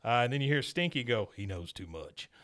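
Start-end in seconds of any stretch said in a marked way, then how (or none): 1.3-2.3 low, soft menacing aside; hammed-up dark whisper of a henchman; sinister mutter. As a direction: drop the voice to a mock villain murmur. Stinky's sinister aside retold.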